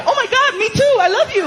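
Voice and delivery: put-on high, squealing girlfriend voice with a laugh; girlfriend gushing. Girlfriend replying to his text, high squealing gush. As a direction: gush back like an excited girlfriend. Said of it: "And she'd reply".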